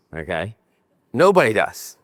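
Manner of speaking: ordinary talk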